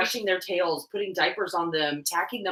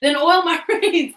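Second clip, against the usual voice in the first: high, loud sassy commands; playful bossy orders; 10 semitones higher, 9 dB louder than usual. Pretend. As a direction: order your friend around, mock-bossy and loud. Bossy orders to co-host, laughing.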